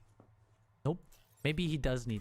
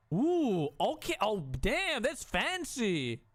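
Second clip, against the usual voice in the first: high, swooping, drawn-out admiration; impressed cooing; 5 semitones higher, much slower than usual. Real, not acted. Player admiring a fancy new build, impressed cooing. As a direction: ooh and aah over it, stretching the last words. Admiring what was just built.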